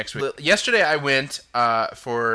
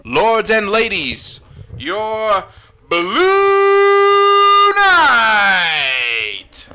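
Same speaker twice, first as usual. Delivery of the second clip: high, loud, drawn-out arena announcer roar; booming announcer impression; 10 semitones higher, 12 dB louder, much slower than usual. Shown as a demonstration. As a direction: belt it out slowly like a show MC, stretching the last words. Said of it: "here's how he said it".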